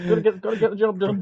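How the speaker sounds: hammed-up strain, cheeks puffed; mock straining. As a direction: push it out like a red-faced player straining. Miming a straining chess player.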